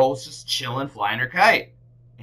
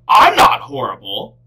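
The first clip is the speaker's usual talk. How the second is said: indignant bear; 13 dB louder than usual. A narrator's reading. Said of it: "he said".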